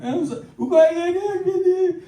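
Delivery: high wailing sob-talk; mock crying. Preacher acting out the crying prayer, high sob-talk. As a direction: wail through the words, mock sobbing.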